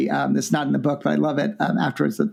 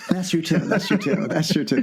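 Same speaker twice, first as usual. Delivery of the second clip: laughing; 3 semitones higher than usual. Real, not acted. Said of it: "laughing at the joke".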